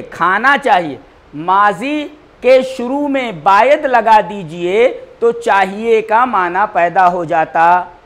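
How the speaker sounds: loud, slow classroom drill; emphatic drilling. Shown as a demonstration. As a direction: hammer each phrase home for students. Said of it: "teacher drills the answer".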